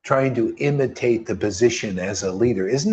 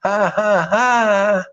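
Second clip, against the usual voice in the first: staged ha-ha laugh, pitched higher; mocking laugh; 6 semitones higher, much slower than usual. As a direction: scoff at the guru with a fake laugh. Mocking laugh after parody.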